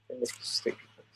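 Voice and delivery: breathy whispered aside; whispering. Real, not acted. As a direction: whisper it as a joke.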